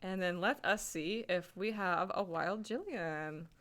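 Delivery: ordinary talk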